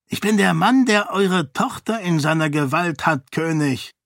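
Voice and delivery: high, imperious monk voice; a fanatic priest giving orders, pitched high; zealous monk. A narrator's reading. Dilaf threatens king. (hohe, herrische Mönchsstimme)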